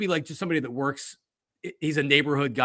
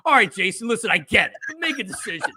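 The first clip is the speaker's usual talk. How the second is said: raised, mock-exasperated urging; impatient urging; 6 semitones higher, 3 dB louder than usual. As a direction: replay an impatient push at a dithering player. Mimicking his own impatient on-air urging.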